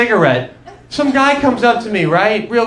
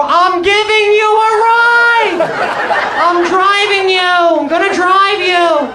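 high, loud, slow excited insistence; hammed-up eager friend; overeager friend; 11 semitones higher, 3 dB louder, slower than usual. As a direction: bellow it like the best news ever. Buddy answers again, mocked.

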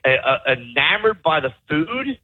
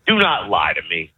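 ordinary talk

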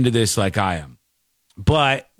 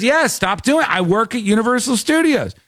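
high, fast and offended insistence; indignant Casey; 9 semitones higher than usual. Retold or imitated. Casey insists to the cops, high-pitched and indignant. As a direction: act insulted that anyone doubts you.

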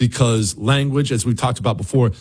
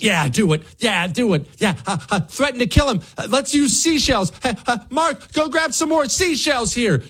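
higher, excited voice urging it on; mock eager egging on; 9 semitones higher than usual. Host imitating Democrats egging each other on, high and giddy. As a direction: hype them up like a giddy accomplice. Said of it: "All you get is".

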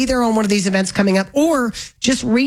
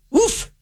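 high woof; 10 semitones higher than usual. Real, not acted. Overwhelmed exclamation about veterans' help.

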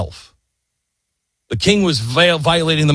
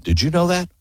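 ordinary delivery

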